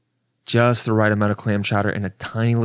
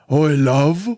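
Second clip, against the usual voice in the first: higher, rising Cockney call; 4 semitones higher, 3 dB louder than usual. Acted. Cockney guy hailing a rich lady, mock accent. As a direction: put on a broad Cockney accent. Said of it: "if the Cockney guy tried to talk to her".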